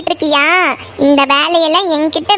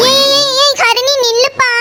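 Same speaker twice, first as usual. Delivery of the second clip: calling out; 8 semitones higher than usual. Acted. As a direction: shout after her to wait. Calling after friend walking away.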